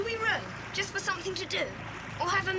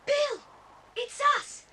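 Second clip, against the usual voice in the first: high, loud, overjoyed cry of his name; excited calling; 8 semitones higher, 4 dB louder than usual. Acted. Boy greeting the rescued Bill, excited loud calling. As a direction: call out with relief at finding him. Boys find Bill freed.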